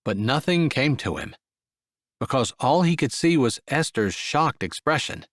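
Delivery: usual speaking voice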